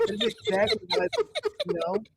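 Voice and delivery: laughing. Real, not acted. Laughing through the remark.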